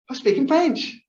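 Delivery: overjoyed at her own progress, high and bright; thrilled. Real, not acted. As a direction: gasp and then blurt it out, thrilled. Gasps, thrilled at speaking French.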